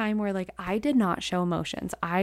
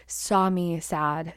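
usual speaking voice

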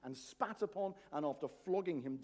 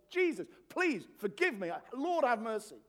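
higher, louder penitent plea; pleading cry for mercy; 7 semitones higher, 9 dB louder than usual. Acted. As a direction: cry out like the tax collector beating his chest. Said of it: "beating our chest and going".

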